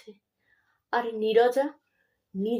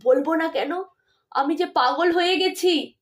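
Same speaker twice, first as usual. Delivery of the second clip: mild sobbing protest, higher; tearful; 6 semitones higher, 4 dB louder than usual. Acted. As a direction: burst into tears, understated. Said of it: "ডুকরে কেঁদে উঠে বলেন".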